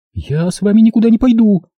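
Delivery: put-on high-pitched drunken refusal; drunken outcry. A narrator's reading. Dinka refuses to join the gang, crying out drunkenly. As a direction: cry out the refusal on a high, sustained note. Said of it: "пьяно выкрикнула Динка".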